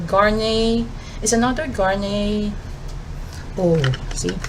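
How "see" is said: usual speaking voice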